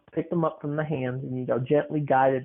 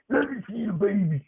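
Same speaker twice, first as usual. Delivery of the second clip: odd grandma voice; 6 semitones higher than usual. Retold or imitated. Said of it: "Why is she talking like that".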